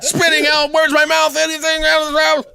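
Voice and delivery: very high, goofy cartoon voice that ends in a shout; silly squeaky voice.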